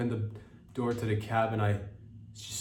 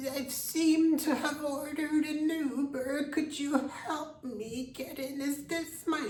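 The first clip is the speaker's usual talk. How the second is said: put-on very high, slow old lady voice; slower than usual. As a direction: plead like a tiny confused grandma. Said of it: "she's looking at her phone".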